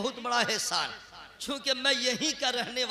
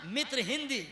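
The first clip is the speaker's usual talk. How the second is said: ordinary delivery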